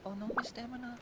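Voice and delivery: high, loud alarmed cry; panicking as the stamina bar empties; sudden high dismay. Real, not acted. Stamina runs out while climbing.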